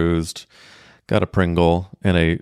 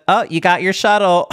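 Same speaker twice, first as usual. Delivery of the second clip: high, louder, sing-song teasing; mocking front desk woman; 10 semitones higher, 4 dB louder than usual. Front desk woman teasing guest about his shuttle, high and mocking. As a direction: needle him like a smug clerk who knew all along. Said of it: "She said".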